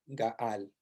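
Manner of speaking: Gaal low and soft with a long a; Hebrew root gaal. Shown as a demonstration. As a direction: stretch the a of Gaal gently. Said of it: "una especie de A y una especie de L".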